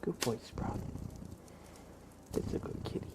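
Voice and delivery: usual speaking voice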